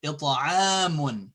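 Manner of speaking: it'amun with the middle a held; it'amun, slow and drawn out; long vowel. Shown as a demonstration. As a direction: stretch "aam" in it'amun. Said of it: "Okay, it's".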